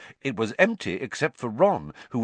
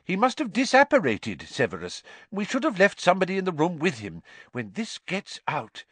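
flustered minister; 9 semitones higher than usual. Acted. Fudge flustered about escape.

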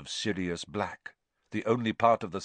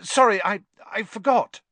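a high, loud, hurried apology; flustered boy; 12 semitones higher, 8 dB louder than usual. A narrator's reading. Harry apologises to Stan, high and flustered. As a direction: apologise quickly and awkwardly.

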